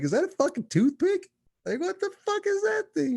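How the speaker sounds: squeaky high mock disbelief; high incredulous voice. Stoner friend staring at a pinner, very high disbelief. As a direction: shriek it up high, baffled by the tiny joint. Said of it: "I'm like".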